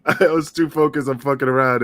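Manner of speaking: laughing out loud, high and loud. Real, not acted. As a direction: laugh through the words. Laughing at own failure.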